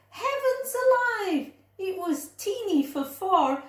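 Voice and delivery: a flustered woman at her wits' end; exasperated cry of an old lady. Acted. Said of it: "The little old lady cried".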